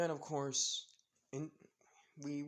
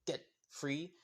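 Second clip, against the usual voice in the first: ordinary delivery, nothing marked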